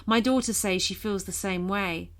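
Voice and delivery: plain reading voice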